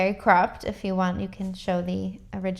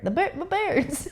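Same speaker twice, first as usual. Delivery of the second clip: higher, breaking into a laugh; laughing stumble; 9 semitones higher than usual. Real, not acted. Photographer tripping over a word while showing the video, laughing. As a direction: trip over the word and crack up.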